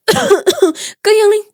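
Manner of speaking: high, choked coughing fit; hard coughing. Acted. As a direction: cough hard after being grabbed by the throat. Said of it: "Banja coughed hard".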